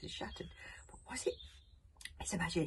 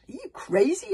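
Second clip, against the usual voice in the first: incredulous; 11 semitones higher, 12 dB louder than usual. Acted. Fishlegs interrupts in disbelief.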